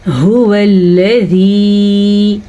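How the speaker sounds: هو الذی with the final "ee" held long; long ya madd. Shown as a demonstration. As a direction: recite slowly, stretching the end of الذی. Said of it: "یا مدہ کو ادا کرنا ہے".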